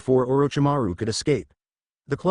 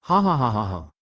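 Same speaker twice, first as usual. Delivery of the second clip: evil laugh. A narrator's reading. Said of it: "the Shukaku started laughing".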